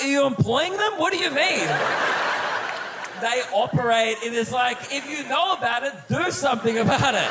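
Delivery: high-pitched, incredulous protest; comic exasperation, pitched well up; exasperated. Acted. Acted exasperated reply to station announcement.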